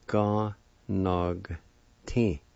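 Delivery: Ga, nog, ti in separate syllables. Shown as a demonstration. Teacher repeating the word for learners, Ga, nog, ti syllable by syllable. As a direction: sound out Ga, nog, ti with gaps between. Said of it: "like this".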